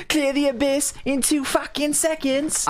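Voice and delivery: high, drawn-out mock British protest; hammed-up British indignation; angry British viewers. Viewers insist on the fast clear, mock British protest. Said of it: "people get angry at me".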